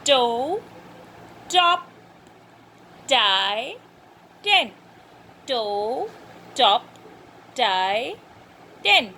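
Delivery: "toe, top, tie, tin" one by one with pauses; word drill. Shown as a demonstration. Teacher has class feel the tongue, spaced pronunciation drill of "toe, top, tie, tin". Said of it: "Say these words and feel".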